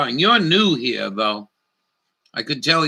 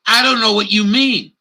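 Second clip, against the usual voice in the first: clerk; 8 semitones higher, 4 dB louder than usual. Retold or imitated. Clerk voiced in retold quarrel.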